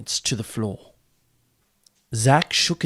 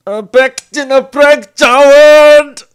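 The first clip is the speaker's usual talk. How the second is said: loud scream, much higher; 16 dB louder, slower than usual. A narrator's reading. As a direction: scream it out in a final burst. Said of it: "Malice screamed".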